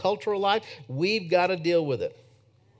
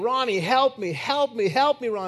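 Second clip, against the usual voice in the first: a mildly raised, retold plea of help me; pleading cry for help; 7 semitones higher than usual. Friend begging Ronnie at night, a mild retold plea. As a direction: imitate a terrified friend begging, only half-voiced. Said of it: "He started screaming".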